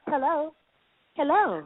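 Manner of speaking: high sultry hello repeated; porn star voice. Retold or imitated. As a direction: replay the seductive greeting, high. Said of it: "I was like".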